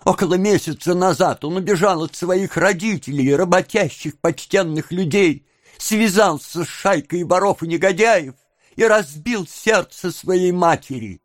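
lightly voiced tale of woe; lamenting young woman. A narrator's reading. Young woman explaining to onlookers, mild lament.

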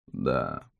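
faint low croak; weak dying voice. Acted. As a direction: answer like a dying man. Dying witness answers questions. (слабый голос умирающего)

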